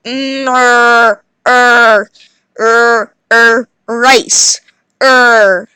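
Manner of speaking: "er" said as a growled r; r sound. Shown as a demonstration. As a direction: curl the tongue on "er". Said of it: "So it should sound like".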